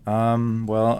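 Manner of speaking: ordinary talk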